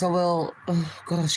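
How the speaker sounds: normal talking voice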